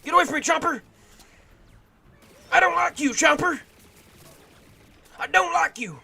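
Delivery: high, loud shouting; panicked yelling. Real, not acted. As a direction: yell at the monster. Yelling at chomper.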